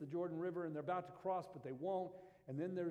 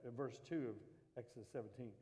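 normal talking voice

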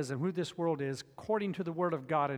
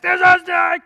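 high yelled alarm, much louder; a boy yelling there's a snake at his brother; retold scream; 11 semitones higher, 15 dB louder than usual. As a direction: shout it in panic from up on the rock. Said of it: "and I was screaming".

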